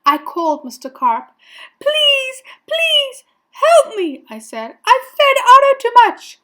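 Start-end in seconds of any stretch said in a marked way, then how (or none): 1.8-4.2 high, loud begging cries; desperate plea. A narrator's reading. Boy phoning Mister Carp for help, desperate high plea. 4.8-6.4 high, fast, loud blurt; panicked confession. A narrator's reading. Boy confessing to Mister Carp, fast high panic.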